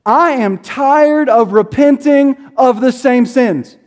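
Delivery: a tired friend venting on the phone. Retold or imitated. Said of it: "he, like, raised his voice".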